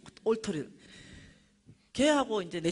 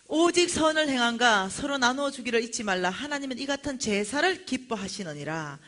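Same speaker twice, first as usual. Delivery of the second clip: loud, raised proclamation slowing at the end; scripture recitation; 5 semitones higher, 8 dB louder than usual. Real, not acted. Preacher reciting Hebrews to the congregation, proclaiming. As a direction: declare the verse to the congregation.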